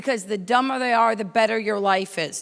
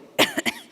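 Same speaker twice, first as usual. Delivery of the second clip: acted choking cough. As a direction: cough as if something is stuck in your throat. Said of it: "I'm, like, choking".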